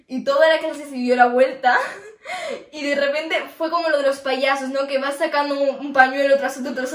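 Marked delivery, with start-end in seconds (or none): 1.8-2.7 high breathy giggle; a quick embarrassed giggle. Real, not acted. Toda la clase se giró. (risita avergonzada)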